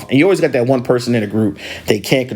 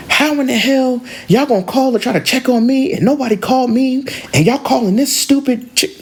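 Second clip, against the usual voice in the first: high-pitched indignant rant; pissed off; 13 semitones higher than usual. Retold or imitated. Angry girl complaining they called Dad, going off. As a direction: imitate her going off about the phone call. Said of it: "the angry girl's pissed".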